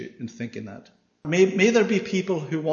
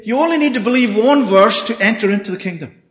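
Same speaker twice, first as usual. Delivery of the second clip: mock false preacher; 6 semitones higher, 9 dB louder than usual. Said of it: "The voice of strangers will say to you".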